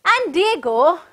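summoning someone by name; calling back. A narrator's reading. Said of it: "So Columbus recalling him says".

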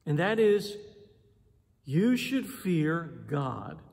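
ordinary talk, nothing marked

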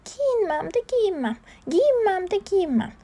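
high-pitched obstinate little chant; stubborn hedgehog refusal. Acted. Hedgehog won't get dressed, high stubborn chant. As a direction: refuse in a high sing-song, without raising volume. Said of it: "tutturdu bizim kirpi giyinmeyeceğim diye".

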